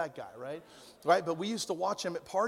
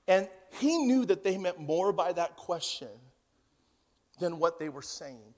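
ordinary delivery

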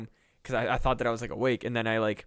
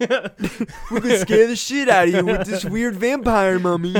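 voiced brain; 9 semitones higher, 10 dB louder than usual. Voicing the brain's threat.

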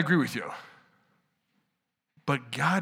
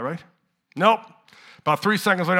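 normal talking voice

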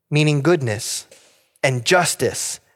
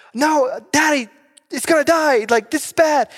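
put-on frightened child; 11 semitones higher than usual. A daughter crying to her daddy that the fish will die. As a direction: shout it like a scared little girl pleading. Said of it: "daughter cries out to dad".

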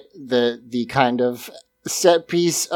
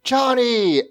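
yelled name; 10 semitones higher than usual. Retold or imitated. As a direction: call out Johnny high and urgent. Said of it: "she just yells".

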